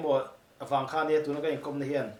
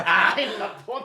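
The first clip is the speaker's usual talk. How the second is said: laughing; 7 semitones higher, 5 dB louder, faster than usual. Real, not acted. Group laughing at the price tag.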